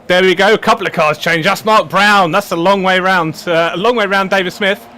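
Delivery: motorsport commentator. Acted. Live race start commentary.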